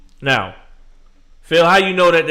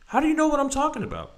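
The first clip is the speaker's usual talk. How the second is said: exasperated; 13 semitones higher, 5 dB quieter, much faster than usual. Real, not acted. Exasperated question to a critic.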